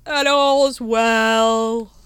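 very high, loud, slow drawn-out call; yelled. Acted. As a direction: bellow it like a night watchman. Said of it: "he yelled".

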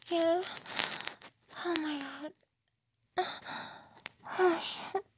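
high, breathy, gasping whispers; overwhelmed, high-pitched breathless cries; breathless gasps. Real, not acted. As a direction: let it break into high, airy gasps.

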